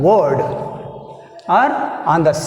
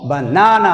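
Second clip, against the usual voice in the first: banana with the second syllable strong and long; banana with a light first syllable, stressed NAA; English word stress; much slower than usual. Shown as a demonstration. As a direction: punch the middle of banana, pitch rising then falling. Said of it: "But in English".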